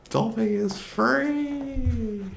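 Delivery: high, drawn-out triumphant call; jubilant, sustained high cry on "free"; exultant cry. Acted. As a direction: proclaim freedom, stretching "free" out long and high. Exultant quote for leaving a job.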